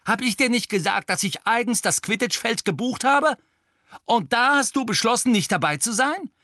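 very high and loud, fury peaking; furious shout. A narrator's reading. Angelina yells at Harry at full fury. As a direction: hit the top of her rage. Angry captain scolds Harry.

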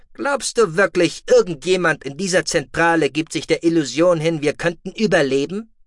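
a calm officer scolding his deputy; stern commander. Acted.

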